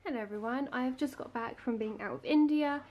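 normal talking voice

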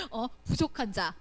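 ordinary delivery

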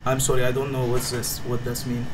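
put-on halting broken English in a thick foreign accent.

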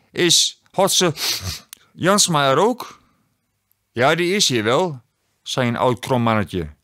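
put-on a mildly stern Sinterklaas calling a child forward; lightly voiced old bishop. A narrator's reading. Sinterklaas reads from his book.

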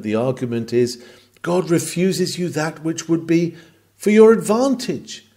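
ordinary talk, nothing marked

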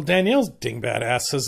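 ordinary talk

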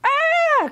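high alarmed scream; high storybook shriek; bird's cry. A narrator's reading. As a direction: cry out like a scorched bird. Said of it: "cried the Warbler".

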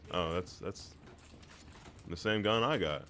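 usual speaking voice

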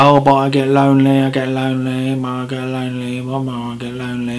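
mocking a lonely churchgoer's whine; mocking whiner. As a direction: sing "I get lonely" like a moaning complainer, dying away. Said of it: "And then they're like".

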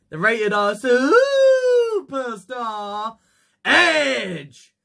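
high, drawn-out star introduction; ring announcer. Acted. Host introducing a returning wrestling star, ring announcer. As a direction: stretch the title, then shout the name.

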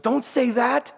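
high, mock-panicked plea; alarmed protest. Husband to wife after the kiss, alarmed protest. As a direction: blurt it out in comic alarm.

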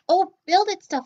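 a bouncy little puppet popping up. Pretend. Puppet Joe talks.

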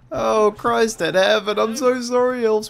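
high, drawn-out cry of dismay; mock horrified apology. Player wails an apology to the attacking elves, mock horrified. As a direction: plead high and slow, hamming it up.

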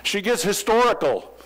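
mildly animated husband complaining; lightly voiced buddy. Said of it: "and he says".

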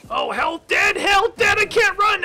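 high, loud panicked yelling; panicked shout. Real, not acted. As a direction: cry out in panic. Player hurt, panicked outcry.

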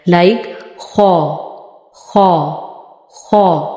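खा said thick and rounded, slowly; a full, rounded खा from the upper throat; heavy round kha. Shown as a demonstration. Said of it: "मोटा भी पढ़ना है और गोल पढ़ना है".

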